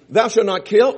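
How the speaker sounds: loud, ringing proclamation of "Thou shalt not kill"; proclaimed commandment. Shown as a demonstration. Preacher booming the commandment at the congregation. Quoting commandment, set against another take.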